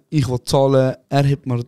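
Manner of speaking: usual speaking voice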